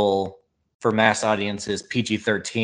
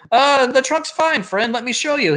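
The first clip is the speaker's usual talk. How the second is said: high, put-on salesman voice; mock salesman; 11 semitones higher, 3 dB louder than usual. Boss defending his car in a commercial, hammed-up salesman. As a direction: pitch it way up, hammed-up reassurance. Retold boss answers heckler.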